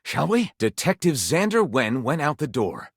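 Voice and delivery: normal talking voice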